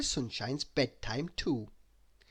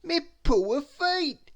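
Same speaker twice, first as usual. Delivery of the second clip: high, slow moaning character voice; high, slow moan over sore feet; moaning Mister Clumsy; 6 dB louder, much slower than usual. A narrator's reading. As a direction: groan it out in a high comic voice. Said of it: "he moaned".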